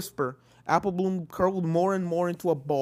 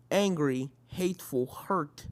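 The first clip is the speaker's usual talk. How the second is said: ordinary delivery, nothing marked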